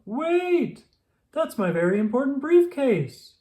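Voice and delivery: high loud call after someone. A narrator's reading. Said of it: "called Mister Brown".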